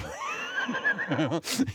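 sudden high laugh; squealing giggle breaking out; high burst of laughter. Real, not acted. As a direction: laugh out at your own punchline. Preacher laughs at his joke.

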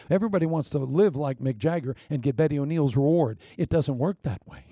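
ordinary delivery

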